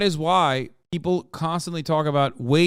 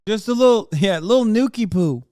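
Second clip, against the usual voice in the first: cutesy mock baby talk; 5 semitones higher, 4 dB louder than usual. Cutesy baby talk about nukes.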